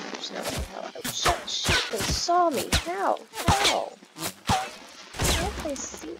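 high-pitched, incredulous wail; startled disbelief. Real, not acted. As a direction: cry out in disbelief at being spotted. Spotted by shooters in game.

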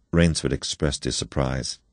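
plain reading voice

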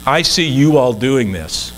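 normal talking voice

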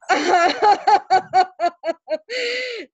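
long, high peal of laughter; real, high-pitched open laugh; delighted laugh. Real, not acted. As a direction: burst out laughing. Laughs after thanking for the raise.

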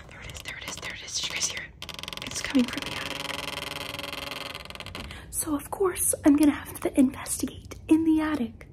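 scared whisper; frantic whisper. Real, not acted. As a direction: whisper it, afraid someone hears. Hears the noise from the attic.

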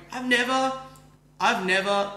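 high, loud stammering awe; amazed stammer. Retold or imitated. To bartender offering pizza, high loud awed stammer. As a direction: stumble over the words in wonder. Retold amazement at bar kindness.

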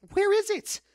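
high, loud demand; exasperated demand. Real, not acted.

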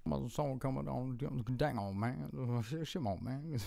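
low, soft mumbled voice; mumbling impression voice. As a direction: mumble it under your breath.

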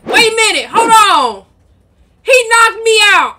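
high, very loud protest; indignant outcry. Pretend. Mother knocked out by Junior in game, indignant shout. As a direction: shout in mock outrage.

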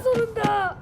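ordinary delivery

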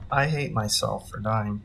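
ordinary delivery

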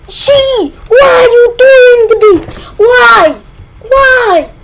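high, loud, tearful yell at the game; frustrated crying shout. Real, not acted. Player yelling at the game character, crying shout. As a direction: scream it out in real despair.

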